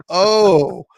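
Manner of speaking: big pretend lightbulb moment; mock realization. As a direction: act out it suddenly clicking. Acting out a sudden realization.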